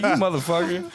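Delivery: high-pitched retold comeback; playful mock outrage. Engineer replying to a friend who was just kidding, mock outraged.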